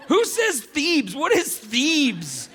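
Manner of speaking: slow, laughing, high question; laughing disbelief. Real, not acted.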